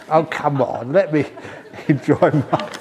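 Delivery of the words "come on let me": ordinary delivery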